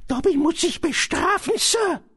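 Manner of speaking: very high-pitched elf voice; high, pleading creature voice; Dobby, contrite house-elf. Acted. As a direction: confess it as a servile little elf.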